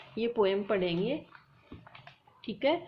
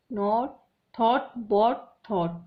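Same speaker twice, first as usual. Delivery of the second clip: नॉट, थॉट said louder and slowly, ending ऑट leaned on; slow stressed endings; 5 dB louder, much slower than usual. Shown as a demonstration. Teacher comparing rhymes, slow and stressed. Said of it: "same है".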